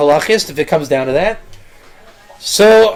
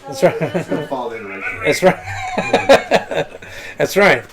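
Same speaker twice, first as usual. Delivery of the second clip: laughing; 5 semitones lower, 7 dB quieter, much slower than usual. Real, not acted. Laughs after student's remark.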